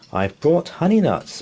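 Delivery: a hint of a cheerful badger; grinning badger. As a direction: offer it with a grin. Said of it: "Badger says with a grin".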